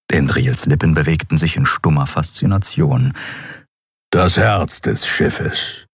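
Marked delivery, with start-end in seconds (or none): 4.1-5.8 slow, reverent reveal; solemn Kero. A narrator's reading. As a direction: say it slowly, as if unveiling a treasure. Kero presents the crystal.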